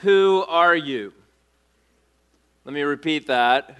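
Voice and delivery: normal talking voice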